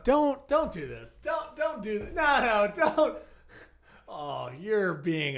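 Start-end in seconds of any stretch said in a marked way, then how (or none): 0.0-3.2 mock pleading. Pleading with the cop.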